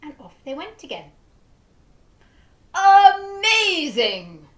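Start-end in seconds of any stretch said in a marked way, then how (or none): 2.7-4.5 loud, high, slow amazed exclamation; amazed cry. A narrator's reading. Duke marvels at the window cleaners, crying out loud. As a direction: cry out in delight. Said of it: "cried the Duke".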